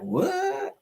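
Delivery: high, surprised "What?"; shocked viewers. Retold or imitated. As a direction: imitate the gasping audience. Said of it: "where y'all be like".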